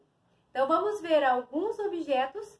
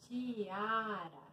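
much softer, lower "Tiara"; soft repeat; 4 semitones lower, 12 dB quieter than usual. Real, not acted. Teacher echoing the word to herself, very soft. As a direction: repeat it under your voice, drawn out.

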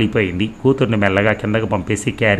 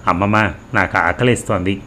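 ordinary delivery, nothing marked

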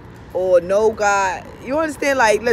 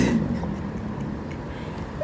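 giddy laugh; 9 dB quieter than usual. Real, not acted.

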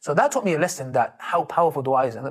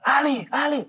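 excited calling; 11 semitones higher than usual. Retold or imitated.

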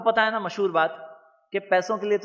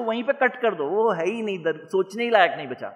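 ordinary talk

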